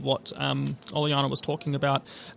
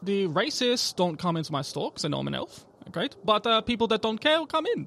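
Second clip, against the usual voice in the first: put-on high, accented elf shopkeeper with a laugh; cheery elf woman; 5 semitones higher than usual. Elf alchemist explains keeping the graffiti, cheery and high. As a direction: chat cheerily in a higher accented voice. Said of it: "you seem awfully cheery".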